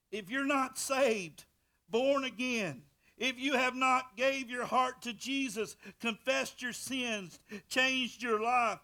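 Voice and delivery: loud, high, rising preacher's cry; fiery preaching. Acted. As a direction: preach it hard at the congregation. Preaching at full force.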